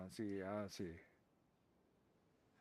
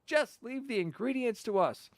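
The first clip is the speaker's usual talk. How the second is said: much higher, eager chirp; high piping sidekick voice; 10 semitones higher than usual. Pretend. Streamer voices the sidekick accepting the quest, high piping. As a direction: chirp it like an overeager mascot.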